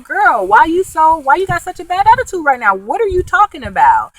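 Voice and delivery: sassy friends challenging Megan's attitude; mock sassy friends. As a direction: imitate friends calling her out, incredulous. Friends confront Megan, high voice.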